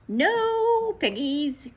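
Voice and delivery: slow, high duck voice; quacking duck. A narrator's reading. Duck answers Grinch, quacking. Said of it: "quacked the duck".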